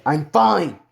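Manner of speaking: loud, emphatic FINE; irritated insistence. Acted. Hero to insistent healers, irritated insistence. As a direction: push the word FINE out hard.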